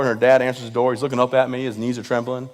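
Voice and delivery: usual speaking voice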